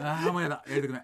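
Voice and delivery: loud theatrical moan of reluctance; mock grumbling. Actors griping together in the dressing room, mock grumbling. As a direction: whine it out as a put-on complaint.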